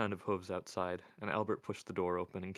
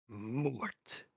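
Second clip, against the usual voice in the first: vicious snap; 4 semitones higher than usual. A narrator's reading. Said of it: "snapped out as fast as a whiplash".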